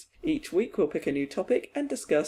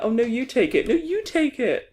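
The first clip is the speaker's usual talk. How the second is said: high, insistent mock politeness; overly polite Canadians; 8 semitones higher, 4 dB louder than usual. Podcast host mocks Canadian football players, sweetly polite voice. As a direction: imitate Canadians endlessly deferring, pitch up. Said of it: "They'd just be like".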